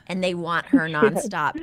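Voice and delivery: normal talking voice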